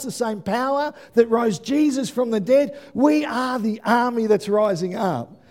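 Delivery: ordinary talk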